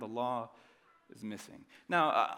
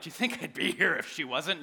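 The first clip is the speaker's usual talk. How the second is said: sarcastic retort; 6 semitones higher, 6 dB louder than usual. Retold or imitated. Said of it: "I'm like".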